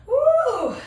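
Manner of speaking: high, loud cheer; excited whoop. Real, not acted. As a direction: let out a happy woo. Cheering mid workout.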